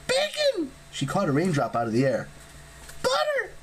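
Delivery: very high squawked words; high harpy squawk; harpy blurting. A narrator's reading. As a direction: snap them out like a bird.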